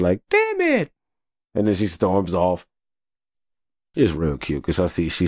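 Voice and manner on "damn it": put-on very high squeaky kid's mad cry; imitating daughter's tantrum. Dad imitating his little daughter stomping mad, squeaky kid voice. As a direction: mimic a little girl stomping her foot. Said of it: "like, stopping her foot".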